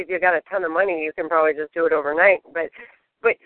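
ordinary delivery

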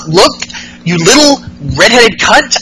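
hammed-up tiny villain threatening her rival; imitating Alexa Bliss. Bliss quoted in wrestling recap.

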